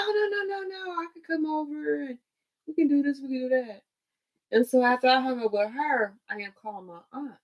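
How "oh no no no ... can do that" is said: higher, quick mock reassurance; cousin's eager reassurance. Cousin insisting on the phone she can still come, higher and eager. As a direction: brush it off brightly.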